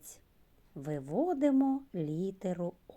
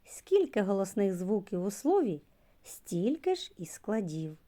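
usual speaking voice

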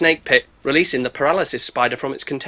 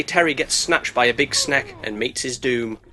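ordinary delivery

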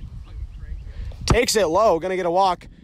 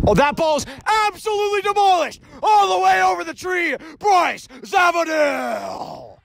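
excited home run call; 8 semitones higher than usual. Real, not acted. Commentator calling a teammate's home run, excited shout. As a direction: belt it like the ball just cleared the trees.

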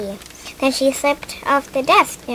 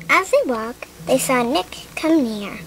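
usual speaking voice

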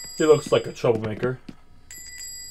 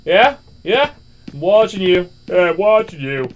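threatening; 4 semitones higher, 6 dB louder than usual. Acted. Cashier threatening shady customer.

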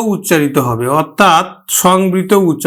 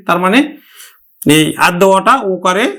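normal talking voice

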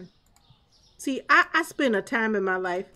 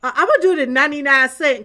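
mock quote; 4 semitones higher, 9 dB louder, faster than usual. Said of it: "you said".

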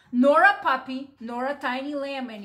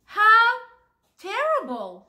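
high, loud gasp of shock; the animals all shocked together; shocked chorus; 8 semitones higher, 6 dB louder, slower than usual. Acted. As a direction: cry out in horror. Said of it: "said everybody".

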